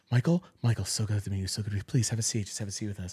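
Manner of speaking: imitated hushed, low welcome; soft, welcoming. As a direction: murmur a gentle invitation to a newcomer. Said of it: "like that, that soft and, and rel-, and welcoming".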